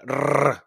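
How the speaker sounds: a long trilled rrr like a motorbike; rolled R. Shown as a demonstration. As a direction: roll the rrr like an engine. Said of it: "that rolling R".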